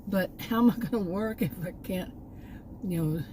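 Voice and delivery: ordinary delivery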